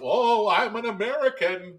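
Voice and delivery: loud, high, hammed-up American; played-up American. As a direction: ham up being an American. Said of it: "really play up".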